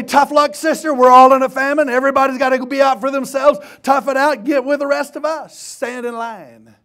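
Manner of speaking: loud, high hectoring of a supplicant; preacher acting out a scornful brush-off; mock harsh refusal. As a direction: shout them down in mock impatience. Imagined callous refusal by Elisha.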